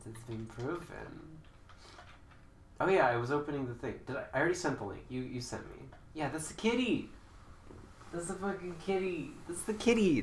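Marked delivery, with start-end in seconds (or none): 6.1-10.2 excited. Real, not acted. Excited about cat video.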